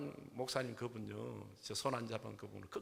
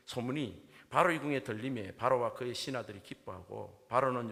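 loud, raised-pitch Bible reading; scripture reading; 2 semitones higher, 7 dB louder than usual. Real, not acted. Pastor reads the Genesis verses to the congregation, loud scripture reading.

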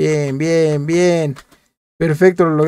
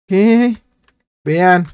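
usual speaking voice

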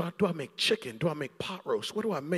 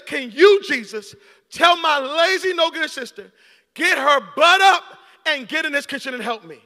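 high, loud demand; hammed-up indignant plea; 8 semitones higher, 11 dB louder than usual. Martha demanding that Jesus send her sister in, loud and high. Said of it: "She says".

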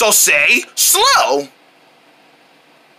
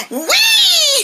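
long, very high whee; gleeful squeal. Acted. Friends running around together, gleeful high squeal. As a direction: let out a high squeal of delight.

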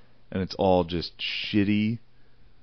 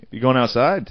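lilting Western PA question, higher pitched; Western PA accent; 4 semitones higher than usual. Shown as a demonstration. Co-host calls to someone walking outside, Western PA accent. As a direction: play up a Western PA lilt. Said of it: "Western P A thing".

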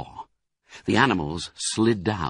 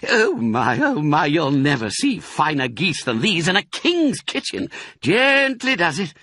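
high, lively, relishing voice; gleeful, bossy fox; 7 semitones higher, 3 dB louder than usual. Acted. Fox directs Badger and children collecting food, gleefully. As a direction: gush over the food while giving jobs.

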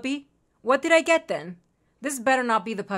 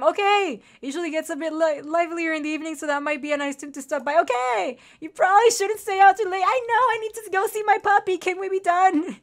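high, loud, frantic read-through; impatient rush; 7 semitones higher, 5 dB louder than usual. Real, not acted. Player needing to go see puppy, frantic impatience. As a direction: race through it, bursting with impatience. Rushing dialogue to reach puppy.

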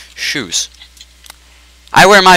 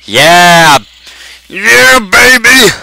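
loud, high, drawn-out celebration; big loud victory cheer; excited cheer; 7 semitones higher, 5 dB louder, much slower than usual. Real, not acted. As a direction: whoop it up, stretching the words. Celebrating a correct game answer.

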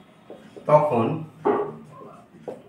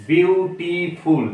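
Beautiful slowly divided into three syllables; 2 semitones higher, much slower than usual. Shown as a demonstration. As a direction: sound out each of the three parts of beautiful. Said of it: "তিনটে উচ্চারণ বা তিনের অধিক উচ্চারণ".

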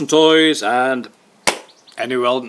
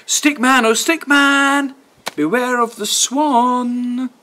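high slow sing-song warning; warning refrain; 10 semitones higher, slower than usual. A narrator's reading. Storyteller warning Stickman, high slow chant. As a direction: draw out the warning.

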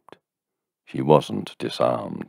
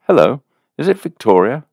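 bright, quick and loud, pitched up; brightly; 11 semitones higher, 8 dB louder, faster than usual. A narrator's reading. A soaked man greeting the landlady, brightly. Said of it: "he says brightly".

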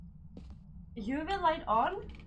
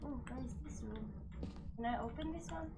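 hushed whisper; 12 dB quieter, faster than usual. Real, not acted. Whispering while hunting ghost.

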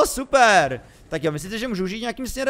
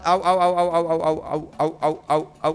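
string of quick ows, loud then easing off; repeated ow cries; 4 dB louder than usual. Real, not acted. Player reacting to the snake bite, repeated pained ows. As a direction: yelp ow over and over.

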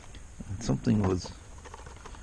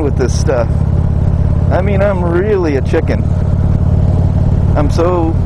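talking loud over road and wind noise on a moving motorcycle; raised voice over wind; 3 semitones higher, 12 dB louder than usual. Real, not acted. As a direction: project the voice over the engine and wind. Talking while riding motorcycle in wind.

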